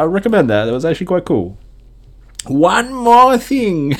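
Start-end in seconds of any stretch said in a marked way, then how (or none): 2.5-4.0 high, slow, loud cartoon old man; hammed-up old uncle catchphrase; Uncle impression. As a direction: wag a finger like Uncle. Imitating Uncle from Jackie Chan.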